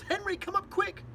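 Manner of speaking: very high, loud panicked call; shrieked summons, pitch leaping up; yelling. A narrator's reading. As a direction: yell urgently for help upstairs. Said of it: "And she yells".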